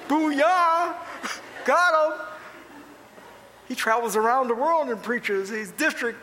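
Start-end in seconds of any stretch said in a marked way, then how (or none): 0.1-2.2 high, punchy mock victory cry; playful raised whoop of triumph; triumphant cheer. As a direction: celebrate like you just scored. Acts out his own triumphant reaction.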